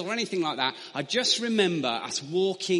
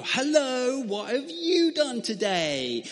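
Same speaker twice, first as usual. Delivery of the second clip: higher and louder, slow sing-song hello; mum's greeting; 7 semitones higher, 3 dB louder, slower than usual. Retold or imitated. Mother greeting her little boy at nursery pickup, slow sing-song. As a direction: greet a small child brightly.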